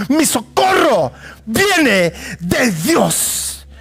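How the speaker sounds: very slow, drawn-out shout of the line; preaching climax. Acted. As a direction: stretch every word out as the peak. Climax of the sermon.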